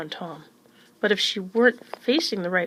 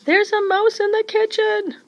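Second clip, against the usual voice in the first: much higher, louder alarmed cry; panicked shout; 6 dB louder than usual. A narrator's reading. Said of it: "shouted Missus Newcomb".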